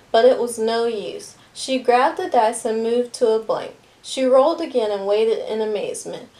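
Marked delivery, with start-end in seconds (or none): none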